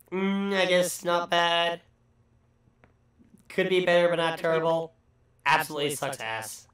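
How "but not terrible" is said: normal talking voice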